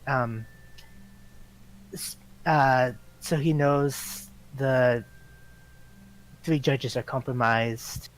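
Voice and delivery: normal talking voice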